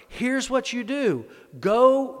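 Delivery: high, emphatic instruction as the prophet; prophet's command. Acted. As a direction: hand down the order, punching "Go" loudly. Acted prophet giving a command.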